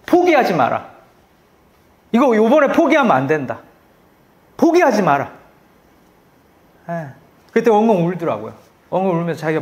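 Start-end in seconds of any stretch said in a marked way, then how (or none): 0.1-5.3 firm urging. Retold or imitated. Pastor urging friend not to quit.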